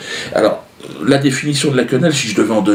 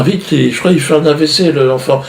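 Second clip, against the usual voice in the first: higher, louder panicked call; mock panic; 6 semitones higher, 4 dB louder than usual. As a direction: cry for the doctor in mock alarm. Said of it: "Elle appelle le médecin". (panique jouée, plus aiguë et forte)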